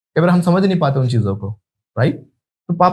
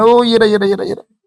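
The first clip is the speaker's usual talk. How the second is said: excited; 5 semitones higher, 4 dB louder than usual. Real, not acted. Catching a bug in shirt.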